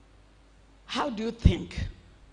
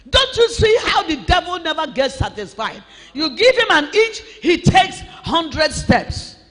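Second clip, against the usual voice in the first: fiery preaching shout; 4 semitones higher, 4 dB louder than usual. Real, not acted. Preacher warning the congregation.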